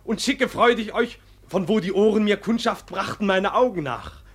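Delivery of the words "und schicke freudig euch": ordinary delivery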